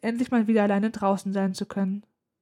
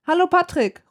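higher, louder hailed greeting; calling out; 8 semitones higher, 6 dB louder than usual. A narrator's reading. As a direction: call over a siren to someone. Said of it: "rufe ich".

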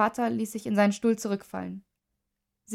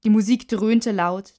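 usual speaking voice

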